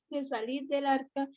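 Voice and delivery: words through a light laugh. Real, not acted. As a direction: let a laugh break in. Laughing while speaking. (riendo suavemente)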